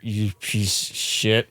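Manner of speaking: put-on low, slow, soft drunken growl; belligerent drunk. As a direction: menace like a sloshed stepdad. Drunk stepdad threat.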